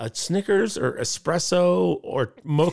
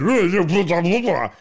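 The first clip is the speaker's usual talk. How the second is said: higher-pitched mocking gibberish; mock babble; 5 semitones higher, 4 dB louder, much slower than usual. Man mimicking his friend's reply at the bar, mocking babble. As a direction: reel off mocking blah-blahs.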